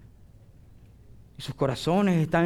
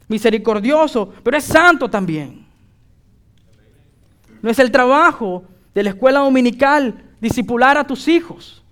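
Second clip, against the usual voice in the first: loud preaching, pitched higher, driving every phrase; predicación fervorosa; 8 semitones higher, 11 dB louder than usual. Acted. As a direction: preach it hard to the congregation. Preaches at full force. (predicación a todo pulmón, más aguda y fuerte)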